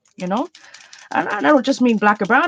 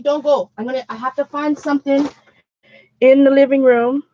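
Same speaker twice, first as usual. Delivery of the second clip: ordinary talk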